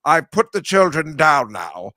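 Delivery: slow creepy British film villain; Jeremy Irons imitation. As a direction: purr it out slowly like a menacing aristocrat. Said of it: "my Jeremy Irons imitation".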